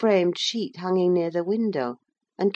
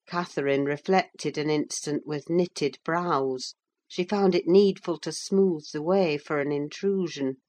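usual speaking voice

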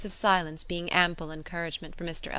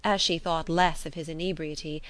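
ordinary delivery

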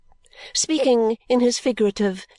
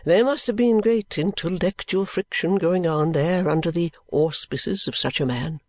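reverent Mr Badger; 4 semitones lower than usual. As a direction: speak with hushed reverence of a great man.

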